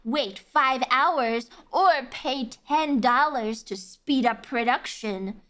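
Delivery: slow, drawn-out game instructions; mocking game prompts. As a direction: recite it slowly, mocking a dull game. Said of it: "It's like".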